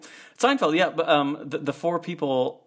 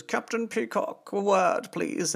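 prim boss; 9 semitones higher, slower than usual. Retold or imitated. Voicing Mister Granger in story.

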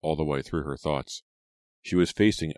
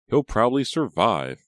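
dry; 7 semitones higher, 7 dB louder than usual. A narrator's reading. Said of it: "Reva replied dryly".

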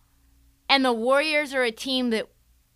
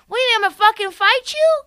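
fast, higher incredulous snap; hammed-up angry coach; mock Spoelstra outburst; 10 semitones higher, 5 dB louder, faster than usual. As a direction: replay the coach's line in disbelief. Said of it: "captured live on video that he actually said".

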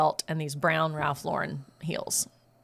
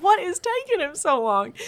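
high, impatient mock-mom voice; imitated exasperated mom; 11 semitones higher than usual. Daughter imitates panicking mom awaiting the hose, exasperated. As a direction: pitch it high, imitating a fed-up mother.

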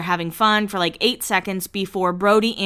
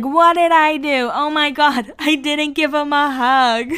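high, drawn-out tipsy protest, breaking into laughter; feisty drunk Lauren; 7 semitones higher, 4 dB louder than usual. Retold or imitated. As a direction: play a feisty drunk, loud at first. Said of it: "giving us feisty drunk Lauren".

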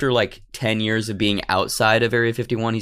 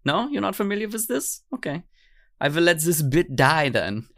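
put-on comic German accent, mostly pitched up; 8 semitones higher than usual. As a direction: explain it earnestly in mock German English. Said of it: "French-Swiss-German accent axis".

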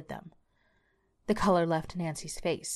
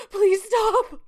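much higher, louder terrified plea; crying out; 7 dB louder than usual. A narrator's reading. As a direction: beg the driver to stop the beating. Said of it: "Nancy cried out".

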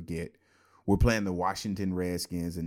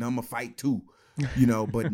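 ordinary delivery

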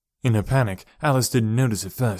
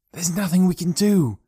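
lightly voiced boy, pitched high; urgent reasoning; 8 semitones higher than usual. A narrator's reading. Boy stops girl calling out, urgent reasoning. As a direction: reason with her anxiously. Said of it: "he reasoned".

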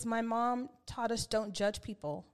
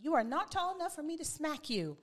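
higher-pitched mock scolding mom; 6 semitones higher than usual. Mom scolding her acting-up son, imitated sternly. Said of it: "and she was like".

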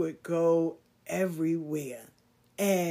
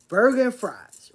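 excited; 5 semitones higher, 8 dB louder than usual. Real, not acted. Excited about favorite meal.

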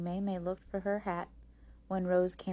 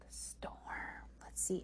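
soft, voiceless whisper; hushed suspense; 10 dB quieter, slower than usual. Real, not acted. Reader guesses what scared the sisters, whispering. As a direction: whisper as if something is lurking.

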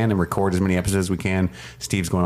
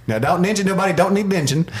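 drunken hillbilly imitation; 11 semitones higher than usual. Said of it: "drunken hillbilly guy he's like".

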